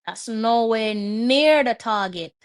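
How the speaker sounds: usual speaking voice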